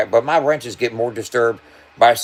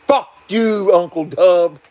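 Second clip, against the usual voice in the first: mock viewer insult; 7 semitones higher, 4 dB louder, much slower than usual. Viewer insulting him straight up.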